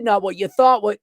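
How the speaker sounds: ordinary talk, nothing marked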